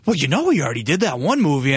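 a hint of a chatty East Coast old lady. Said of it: "cute little East Coast accents".

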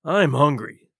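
mild captain's growl; grumpy, hungry growl. A narrator's reading. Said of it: "growled the captain".

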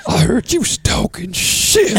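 high, slow falsetto, breaking into laughter; imitating Kathy. Retelling the booker's accusation in a high, slow falsetto. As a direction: squeak the accusation out slowly, giggling. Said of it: "That voice".

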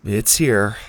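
curt, grudging confirmation; irritable. A narrator's reading. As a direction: admit it with irritation. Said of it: "Susie nodded irritably".

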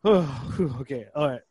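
broken off by puffs; acting winded, huffing between words; out of breath. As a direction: give up mid-phrase to catch your breath. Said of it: "how out of breath I was".

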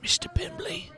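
hushed whispered name; secretive, breath-only reveal; conspiratorial whisper. Acted. Drunk informant names next victim.

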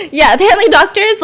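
high shrieking laugh spilling into words; real laugh breaking out; burst of laughter. Real, not acted. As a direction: laugh loud and high, then push on. Laughing at her own joke.

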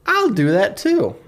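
high swooping ooh, then eager self-important boast; dim wannabe aristocrat. Acted. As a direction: play a foolish rich man, pleased with himself. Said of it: "Dexter, he's like".

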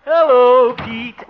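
high, sing-out hello; calling a greeting. Acted. Harold dropping into the marshal's office, calling a greeting. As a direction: announce yourself on walking in.

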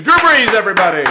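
loud, high sign-off like a host; announcing the guest. Real, not acted. Host thanks the guest on the podcast, loud announcer. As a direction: send the guest off in big announcer style.

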